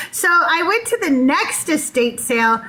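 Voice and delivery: usual speaking voice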